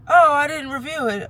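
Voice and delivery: high-pitched imitation of a brush-off; mock dismissive doctor. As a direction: mimic an unhelpful doctor, pitched well up.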